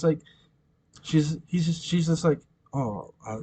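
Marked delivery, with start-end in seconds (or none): 2.7-3.4 softly mumbled mock condolence; understated, barely reacting; unfazed apology. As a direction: apologize without a trace of shock. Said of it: "she's not even, like, batting an eye".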